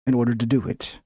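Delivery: put-on low reporter voice; investigative journalist voice. Shown as a demonstration. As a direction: drop slightly into investigative reporter mode. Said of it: "it's hard to do my investigative journalist voice".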